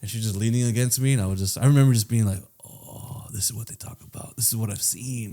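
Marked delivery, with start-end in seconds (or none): 2.7-5.3 very soft, breathy wonder; imitating quiet, blissful amazement; hushed awe. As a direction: murmur it as a dazed revelation. Younger self overwhelmed by contact.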